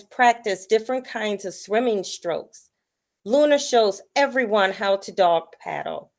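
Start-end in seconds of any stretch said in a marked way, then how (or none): none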